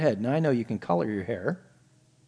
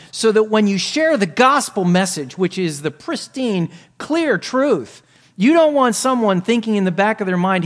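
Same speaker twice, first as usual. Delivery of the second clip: higher, louder preaching push; rising sermon delivery; full-force preaching; 7 semitones higher, 7 dB louder than usual. Acted. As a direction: preach it hard to the congregation. Preacher raises voice.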